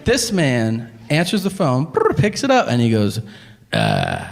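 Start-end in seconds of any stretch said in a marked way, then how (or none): 3.7-4.2 a dull low "uh" grunt, barely awake. Retold or imitated. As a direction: grunt into the phone like a caveman. Phone man grunts on answering.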